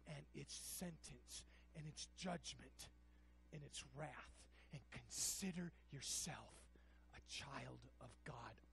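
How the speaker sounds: put-on tender near-whisper on a child of God; soft hushed. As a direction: pull them in close and hush.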